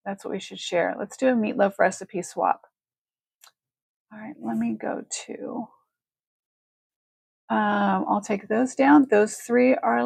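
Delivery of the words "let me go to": ordinary delivery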